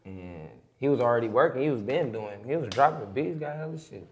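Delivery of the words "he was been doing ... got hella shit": usual speaking voice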